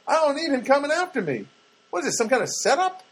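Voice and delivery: high, worked-up suspicious protest; mock indignation. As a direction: play up the paranoid outrage.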